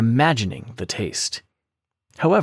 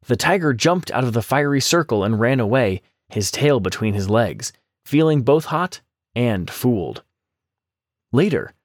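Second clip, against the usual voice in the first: ordinary delivery, nothing marked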